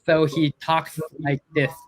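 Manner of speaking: slow, lisping "talks like this". Retold or imitated. Guest imitating the mentor's mentor with a slow lisp. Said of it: "my mentor has a lisp".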